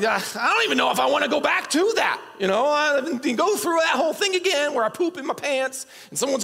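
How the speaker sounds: high, rising comic exasperation; mock bewildered protest. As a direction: play a baffled literalist getting worked up. Acting out a baffled listener's protest.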